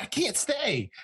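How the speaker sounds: very high, strained retold plea; exasperated urgency. Interrogator tells prisoner he cannot stay, exasperated urgency. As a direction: push it up high in urgent protest.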